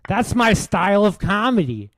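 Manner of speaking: loud, high-pitched whiny comedian parody; Bill Burr. Acted. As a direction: shout it as a mocking, high whine. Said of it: "I'm showing him what he sounds like".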